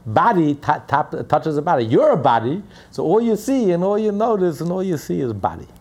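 put-on old Polish rebbe accent. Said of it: "in the Polish accent".

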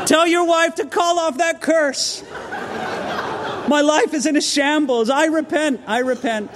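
lightly voiced frantic plea, half laughing; mock begging man, breaking into laughter; pleading man. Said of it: "begging to speak to the pastor".

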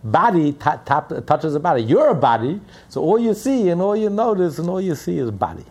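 put-on old rebbe in a Polish accent. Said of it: "in a Polish accent".

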